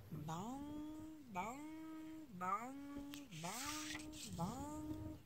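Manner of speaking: high, drawn-out bong like a church bell. Acted. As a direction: ring it out like a big bell. Mother voicing church bell.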